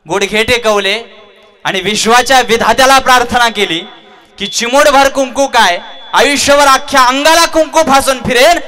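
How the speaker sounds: high, loud, swelling fervent cry; impassioned devotion. Acted. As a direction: pour out total devotion. Hanuman's devoted prayer climax.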